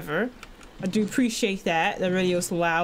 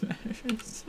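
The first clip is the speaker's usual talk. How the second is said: usual speaking voice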